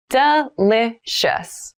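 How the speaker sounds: Delicious stretched out slowly; slow careful repeat. Shown as a demonstration. Teacher models Delicious for learners, slowly. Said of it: "vocabulary drill, repeated for learners".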